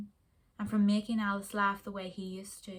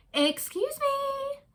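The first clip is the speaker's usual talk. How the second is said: much higher, slow, polite flamingo call; much higher, slow, polite flamingo interrupting; much slower than usual. Acted. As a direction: stretch it out like a prim little bird. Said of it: "said the first flamingo again".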